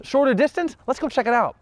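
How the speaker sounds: high, eager and bright with hope; giddy car-shopper excitement; excited. Retold or imitated. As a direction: pitch it way up like you found the deal. Excited about a nearby car.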